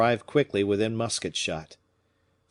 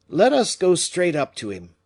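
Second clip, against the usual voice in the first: determined; 9 semitones higher, 7 dB louder than usual. A narrator's reading. Fouquet resolves boldly.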